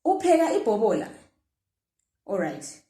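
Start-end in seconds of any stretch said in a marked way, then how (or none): 0.1-1.1 "Upheka ibhobola" asked high and fast; fast high question. Shown as a demonstration. Teacher asks "Upheka ibhobola" in a quick raised question tune. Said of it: "when it's a question".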